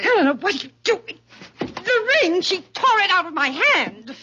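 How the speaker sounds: high, fast, startled cry as the ring is torn away; alarmed mother losing the ring; shocked outcry. Acted. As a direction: cry out in shock, pitch jumping up. Ring snatched from her hand.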